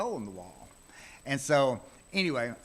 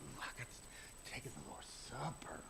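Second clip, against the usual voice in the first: hushed, awed whisper; whispered thought; 4 semitones lower, 15 dB quieter than usual. Retold or imitated. Five-year-old whispering with awe at communion. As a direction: breathe it out in childlike awe.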